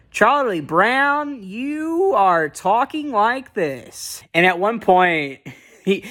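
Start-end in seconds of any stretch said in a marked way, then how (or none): 0.1-4.2 slow, drawn-out, higher cartoon-kid delivery; Peanuts character voice. Mocking how Peanuts kids talk, slow exaggerated cartoon voice. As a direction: stretch each word out like a Peanuts kid. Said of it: "they talk like this".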